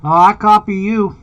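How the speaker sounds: ordinary talk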